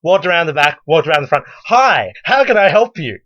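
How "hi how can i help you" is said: bright, high customer-service greeting; cheery shop voice. Acted. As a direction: greet her like a brand-new cheerful clerk. Plays manager at the front.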